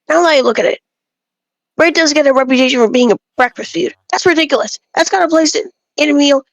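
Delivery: usual speaking voice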